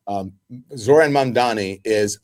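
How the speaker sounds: ordinary talk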